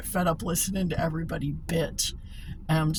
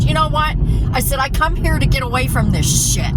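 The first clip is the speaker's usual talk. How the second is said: loud, higher-pitched exasperated retort; retold outburst at a grumpy coworker; snapping; 8 semitones higher, 8 dB louder than usual. Worker telling a griping coworker off, snapping. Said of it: "one day I snapped".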